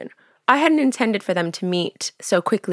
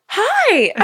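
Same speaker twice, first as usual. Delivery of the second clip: a much higher, louder, excited "Hi" as the mom; starstruck hi; 6 dB louder than usual. Retold or imitated. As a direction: gasp and squeal a hello to the celebrity.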